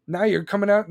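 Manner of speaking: ordinary talk, nothing marked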